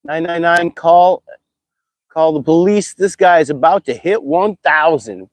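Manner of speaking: ordinary delivery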